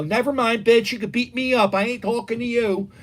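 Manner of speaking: high, dismissive retort to a caller; mock brush-off. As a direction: act out rudely dumping a caller.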